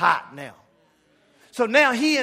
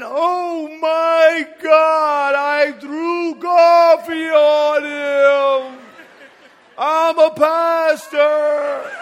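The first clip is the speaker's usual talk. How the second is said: loud, dragged-out slow-motion shout; slow-motion panic; 7 semitones higher, 11 dB louder, much slower than usual. Retold or imitated. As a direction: play it back in slow motion, horrified. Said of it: "it was in slow motion too".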